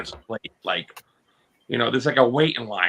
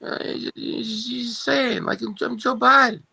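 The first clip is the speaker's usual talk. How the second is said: put-on high, halting old man rambling; Joe Biden impression; 6 semitones higher than usual. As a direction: stumble through it like sleepless Biden. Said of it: "working on my Joe Biden".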